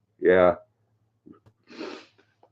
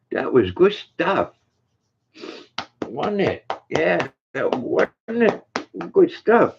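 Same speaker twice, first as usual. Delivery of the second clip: slow, much higher sing-song praise for the dog; 9 semitones higher, slower than usual. Real, not acted. Praising the dog in baby talk.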